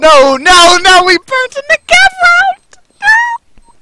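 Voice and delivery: shrill despairing scream. Real, not acted. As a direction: scream in disbelief. Round lost, screaming in dismay.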